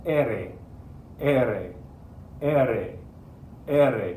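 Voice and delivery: "R" as E-re, one tap, repeated with pauses; single-tap ere. Shown as a demonstration. Teacher leading students to practice aloud, single tongue flap. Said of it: "try to say it out loud".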